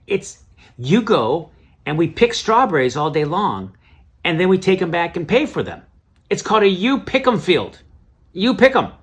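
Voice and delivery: loud, high, mock-thrilled explaining; imitated gleeful wife. Wife explaining strawberry picking to husband, loudly thrilled.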